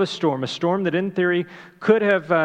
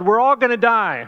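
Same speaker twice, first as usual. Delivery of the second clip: mock panic, higher and louder; panicked yell; 7 semitones higher, 7 dB louder than usual. Preacher imagines Jesus waking in terror, a half-mocking panicked yell. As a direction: yell it like a man who thinks the boat is sinking. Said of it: "he yelled".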